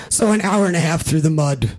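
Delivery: ordinary talk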